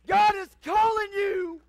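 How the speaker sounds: loud, high pleading altar call; preaching at full force. Real, not acted. Pastor pleading with the congregation, preaching hard. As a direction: plead and shout to the pews.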